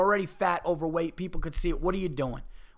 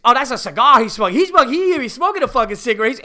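much higher, loud mock scoff; hammed-up rude woman; 8 semitones higher, 12 dB louder, faster than usual. Comedian mocks the rude woman scoffing about the cigar, high and loud. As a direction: ham up her scornful brush-off. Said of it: "So fucking rude".

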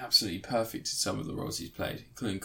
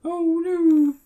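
high, loud falsetto "Oh no"; mock fright; 8 dB louder than usual. Friend echoing fake horror, loud high squeal. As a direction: shriek in hammed-up horror.

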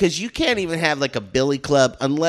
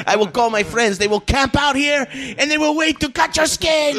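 hammed-up threat; 11 semitones higher than usual. Guard threatening the fleeing teens, hammed up rising. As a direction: ham up the guard's threat till it peaks.

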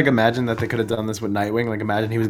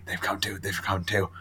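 hushed dread; 2 semitones lower, 11 dB quieter than usual. Retold or imitated. Daredevil's despairing quote.

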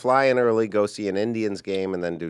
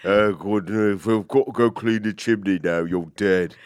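hammed-up Cockney murderer; 3 semitones lower than usual. Comic playing a murderer from the British series, mock Cockney. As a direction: play it as a comic Cockney killer. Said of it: "I'm a Cockney person".

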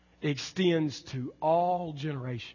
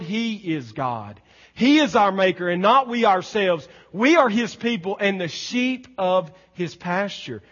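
raised, driving recital of the psalm; scripture proclamation; 4 semitones higher, 8 dB louder than usual. Acted. Preacher recaps the psalm stanzas for the congregation, proclaiming forcefully.

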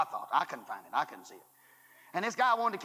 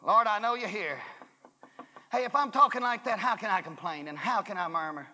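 usual speaking voice

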